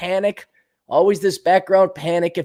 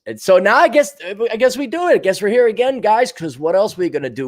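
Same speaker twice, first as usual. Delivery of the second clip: usual speaking voice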